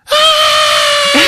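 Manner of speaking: high, loud shriek; horrified mock scream. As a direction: imitate a scandalized mother's scream. Mom reacts to whispered swear word.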